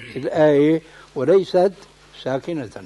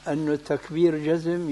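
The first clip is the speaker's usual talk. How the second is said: ordinary talk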